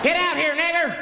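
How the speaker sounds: high-pitched mock yelling. Caller breaks off from emergency call, high mock yell.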